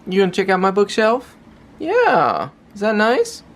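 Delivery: high-pitched doting questions; pet talk. Real, not acted. Talking to the pet Ollie.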